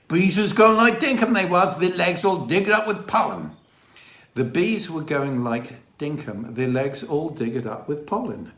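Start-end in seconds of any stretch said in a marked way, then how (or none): 0.1-3.5 broad Dorset, high and loud; imitated Dorset countryman, pitched up; Dorset dialect. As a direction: repeat it in the villager's thick Dorset accent. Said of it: "The Dorset dialect".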